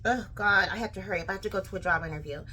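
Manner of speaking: soft, low, rushed muttering; hurried aside. Real, not acted. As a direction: mutter it quickly to yourself. Realises aloud that she is late.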